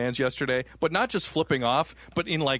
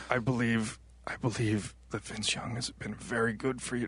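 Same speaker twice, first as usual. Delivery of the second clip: put-on old man voice; 5 semitones lower, 8 dB quieter than usual. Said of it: "my old man impression".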